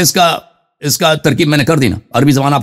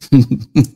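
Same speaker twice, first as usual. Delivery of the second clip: brief derisive chuckle; short chuckle; 4 semitones lower than usual. Real, not acted. Debater scoring a point on opponent's misreading, short chuckle.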